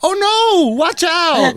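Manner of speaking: high-pitched imitation of an alarmed girlfriend; girlfriend's warning. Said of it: "[name] goes".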